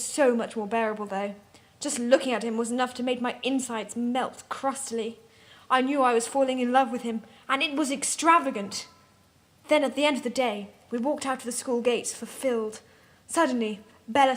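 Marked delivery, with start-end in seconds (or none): none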